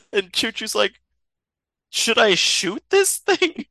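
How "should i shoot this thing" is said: high, slow, hesitant question; mock uncertain question. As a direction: ask it high and drawn out. Character unsure whether to shoot cat.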